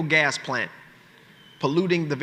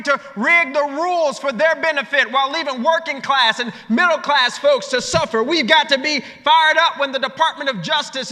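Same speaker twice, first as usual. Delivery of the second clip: high, ringing political speech with stretched key phrases; full-force rally oratory; 10 semitones higher, 4 dB louder than usual. Real, not acted. Speaker rallying progressives against those in power, impassioned full-force oratory. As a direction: preach it to the hall, punching the refrain.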